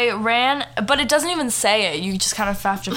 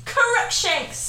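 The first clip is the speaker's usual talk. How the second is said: high-pitched mock battle cry; mock shouted spell; 12 semitones higher than usual.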